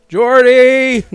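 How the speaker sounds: loud, high mock call. Gamer calling to fallen Jordy, mock calling out. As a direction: call out in put-on concern.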